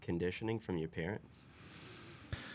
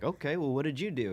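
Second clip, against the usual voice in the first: gentle dad voice; 8 semitones higher, 3 dB louder than usual. Retold or imitated. Dad asking his frustrated son in the car, gentle high parent voice. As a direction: coax a toddler kindly in a high, louder voice.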